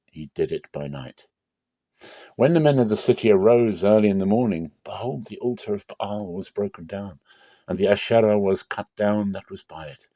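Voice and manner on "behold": ordinary delivery, nothing marked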